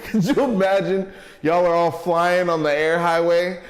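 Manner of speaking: high, loud, laughing through every word; giggling hard as you describe it; laughing while talking. Real, not acted. Laughing through flying car picture.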